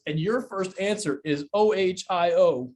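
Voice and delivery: O H I O spelled out slowly, letter by letter. Shown as a demonstration. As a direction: spell O H I O out deliberately. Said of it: "Explain that to me".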